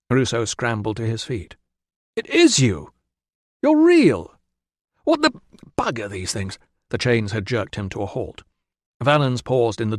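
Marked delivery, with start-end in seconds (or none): 2.2-6.6 shocked high exclamation; startled. A narrator's reading. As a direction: blurt it in disbelief. Prisoner startled, jerked by chains.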